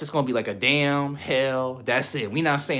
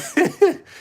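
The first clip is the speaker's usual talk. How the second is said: high laugh; 5 dB louder than usual. Real, not acted. Laughing at own joke.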